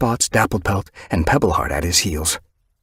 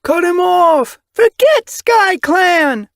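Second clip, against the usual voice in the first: very high, loud rallying cry; crowd yowling; 7 dB louder, slower than usual. Acted. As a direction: yell it like the whole crowd agrees. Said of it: "yowls of agreement".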